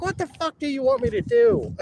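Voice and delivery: high, strained, incredulous shout; exasperated retort. Retold or imitated. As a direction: throw the question back, fully fed up. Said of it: "I'm like".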